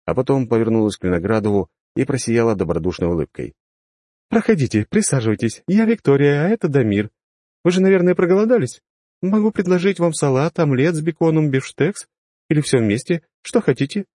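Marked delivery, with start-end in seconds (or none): 4.3-12.1 high, beaming welcoming woman; hospitable hostess. Acted. Hostess voiced by narrator. 12.5-13.2 high, bright rising offer; all of it, with a lift on или; cheerful offer. Acted. Hostess offers food. 13.4-14.1 high, beaming welcoming woman; hospitable hostess. Acted. Hostess voiced by narrator.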